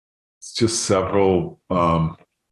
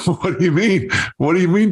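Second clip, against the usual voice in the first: high-pitched, baffled retort; retold astonishment, voice pitched way up; incredulous; 11 semitones higher, faster than usual. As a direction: question it in mock disbelief, pitch jumping up. Retold baffled reply to Bob.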